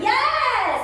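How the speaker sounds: sudden high, loud yes; sudden praise. Real, not acted. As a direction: snap a delighted yes to mark it.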